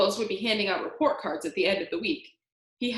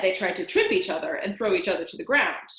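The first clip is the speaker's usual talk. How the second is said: ordinary delivery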